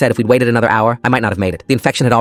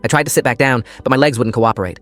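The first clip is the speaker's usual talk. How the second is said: ordinary delivery, nothing marked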